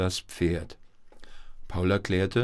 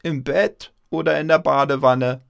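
loud, higher-pitched shout from the kitchen; ringing grandma call; 6 semitones higher, 8 dB louder than usual. A narrator's reading. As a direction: call back teasingly as Grandma. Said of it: "Eine Oma-Stimme schallte aus der Küche". (schallende Oma-Stimme aus der Küche)

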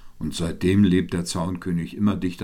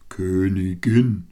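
"Königin" slow and firm, ending drawn out; correcting; 5 dB louder, slower than usual. A narrator's reading. Owl corrects the swift, stretching "Königin" firmly. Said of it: "korrigierte die Eule".

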